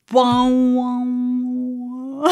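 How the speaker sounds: loud, drawn-out gong boom; imitated gong. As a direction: let it ring out like a struck gong. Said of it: "It was literally like a gong".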